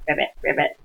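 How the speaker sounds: low "ribbit, ribbit" croaking; frog croak. Shown as a demonstration. Teacher demonstrates the frog sound, low croaking. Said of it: "what does a frog do?".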